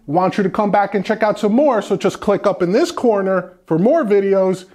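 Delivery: loud YouTube outro voice; upbeat outro. Acted. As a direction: pitch the channel with energy. Outro call to subscribe.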